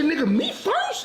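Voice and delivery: high, shocked squeak of disbelief; mock-terrified squeak of disbelief. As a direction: jump way up in alarm. Shocked retold question.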